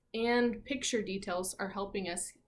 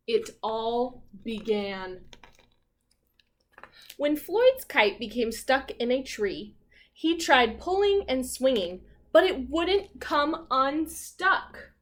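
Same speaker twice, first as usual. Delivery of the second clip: louder, lively picture-book reading; story read-aloud; 5 dB louder, slower than usual. A narrator's reading.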